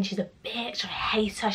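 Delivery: hushed, voiceless whisper; whispered venting. Retold or imitated. Telling her parents about her friend, whispered venting. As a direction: whisper the insult as if not to be overheard.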